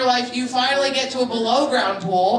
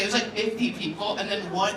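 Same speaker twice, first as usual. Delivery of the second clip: ordinary talk, nothing marked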